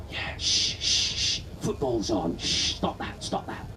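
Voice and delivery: hushed shushing, mostly whispered. Retold or imitated. A football fan shushing his conscience, whispered. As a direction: shush the conscience so the match can be heard. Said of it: "a little voice going".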